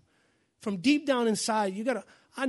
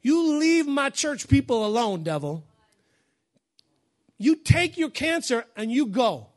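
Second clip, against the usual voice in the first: loud, commanding rebuke of the devil, the last line drawn out slowly; preaching at full force; 4 semitones higher, 5 dB louder than usual. Acted. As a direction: order the devil out of the church with full pulpit force.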